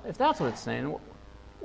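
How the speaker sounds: usual speaking voice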